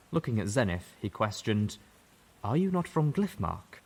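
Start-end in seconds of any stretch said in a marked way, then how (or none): 2.4-3.8 put-on higher, questioning young buck; young zebra voice. A narrator's reading. Young zebra asking Zenith where she comes from, voice higher. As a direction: ask with wary curiosity.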